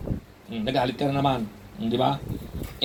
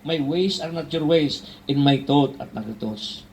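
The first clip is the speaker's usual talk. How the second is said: solemn scripture; 3 semitones lower, slower than usual. Acted. Slow solemn scripture quote.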